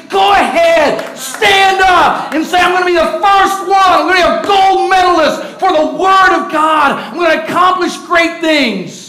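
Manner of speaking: rally the crowd at full force; top-of-lungs pulpit shout; preaching shout. Acted.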